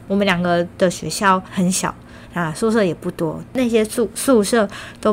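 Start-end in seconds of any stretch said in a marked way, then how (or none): none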